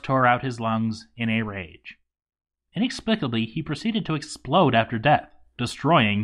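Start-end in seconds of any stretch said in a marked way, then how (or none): none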